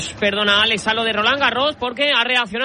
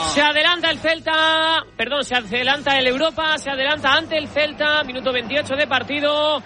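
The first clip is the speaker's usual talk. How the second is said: excited; 6 semitones higher than usual. Real, not acted. Co-host reacts to the goal.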